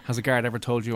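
ordinary talk

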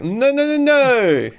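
high, loud drawn-out wail of no; anguished no. Acted. Elon Musk wailing as Cruise mocks him, high and loud. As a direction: wail each no long and high. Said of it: "And he's like".

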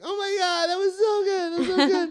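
very high, tearful gush; mock crying. Sister after the standing ovation, mock crying. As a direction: imitate someone weeping with pride. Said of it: "like, crying, like".

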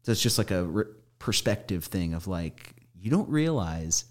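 ordinary talk

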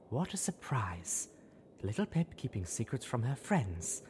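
slow, unvoiced sardonic aside; sarcastic whisper. A narrator's reading. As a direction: mutter the irony under her breath.

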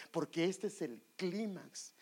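usual speaking voice

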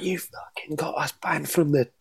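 angry Glaswegian chef, slow and cursing; Glaswegian accent. Retold or imitated. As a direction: growl it like a furious Glaswegian chef. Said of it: "That was a very convincing Glaswegian accent there".

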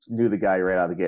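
ordinary talk

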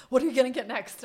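loud, keen question about the next watch; eager question. Retold or imitated. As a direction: ask it bursting with eagerness. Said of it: "immediately he was like".

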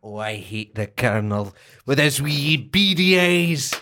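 a lightly put-on Scottish dad from the film; Mike Myers Scottish dad. A cohost quotes the Scottish dad from So I Married an Axe Murderer in a light Scottish accent. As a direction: grumble like a Scottish father in a comedy. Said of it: "So I Married an Ex-Murderer".

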